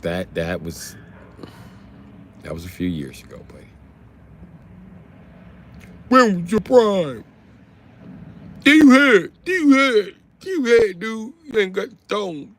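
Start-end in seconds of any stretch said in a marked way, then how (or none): 6.1-12.5 high, loud mocking squawk. Streamer jeering at chatters in a high caricature voice. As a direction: repeat it like a taunting parrot.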